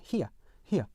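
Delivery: British here as "hee-uh", weak final schwa; British diphthong. Shown as a demonstration. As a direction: let here fade into "uh", British, no R. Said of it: "어 사운드가 약하게 있어요".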